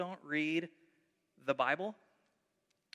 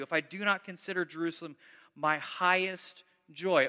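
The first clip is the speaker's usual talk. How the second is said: dramatic scripture reading; 5 dB louder than usual. Acted. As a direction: declaim the lament with weight. Reads psalm aloud with force.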